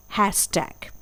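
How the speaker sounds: हैशटैग softly as "hash-tag"; pronunciation demo. Shown as a demonstration. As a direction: repeat हैशटैग for learners. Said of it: "एक बार फिर से".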